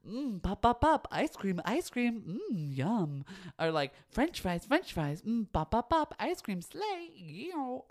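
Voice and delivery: high, loud chirpy NPC sound effects; AI NPC TikTok live. Retold or imitated. Mocking AI NPC streamers for her viewers, high and chirpy. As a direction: bounce out the pops like a livestream NPC. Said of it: "when they're like".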